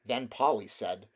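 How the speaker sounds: plain reading voice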